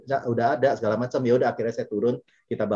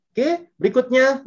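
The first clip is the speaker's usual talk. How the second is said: bright announcement; 13 semitones higher, 7 dB louder, slower than usual. Real, not acted. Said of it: "moving to next topic".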